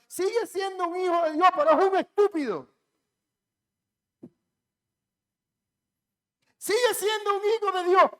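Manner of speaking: loud, high fiery preaching; thundering sermon peak; full-force preaching. Acted. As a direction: drive it home from the pulpit. Preaching climax.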